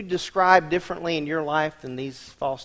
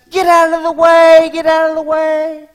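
sputtering foiled villain; 13 semitones higher, 13 dB louder than usual. Retold or imitated. Driver yells at slow traffic.

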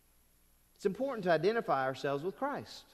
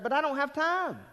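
much higher, louder whiny excuse; mock complaint; 10 semitones higher, 4 dB louder than usual. Preacher voicing a churchgoer who claims no time, higher and louder. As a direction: whine it like someone dodging the work.

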